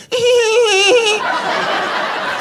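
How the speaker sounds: high tittering giggle; a tiny high giggle; doughboy giggle. Retold or imitated. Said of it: "he'd go".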